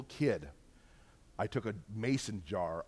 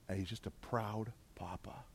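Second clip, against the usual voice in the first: soft unvoiced whisper, much softer, overcome with emotion; choked whisper; 11 dB quieter than usual. Real, not acted. Telling the church about Jesus in the stands, whispered. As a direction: let it fall to a breathless whisper.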